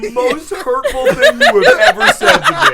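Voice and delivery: high-pitched protest through laughter; laughing mock hurt. Real, not acted. Player claims to be hurt by the remark, laughing protest. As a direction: wail it while cracking up.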